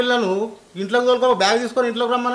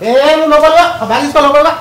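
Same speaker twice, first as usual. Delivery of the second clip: loud call to come inside, raised a bit; 3 semitones higher, 7 dB louder than usual. Acted. Father calls his daughter in from outside, loud. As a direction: shout her in from the doorway.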